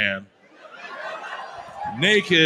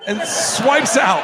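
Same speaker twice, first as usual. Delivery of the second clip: laughing; 5 semitones higher, 4 dB louder, slower than usual. Real, not acted. Laughing through the punchline.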